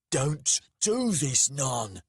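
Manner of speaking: loud, high, drawn-out shout; roar. A narrator's reading. Dec shouts at Nanak mid-brawl, roaring. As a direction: roar each word slowly as a plea to stop. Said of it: "he roared".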